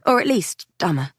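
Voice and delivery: ordinary delivery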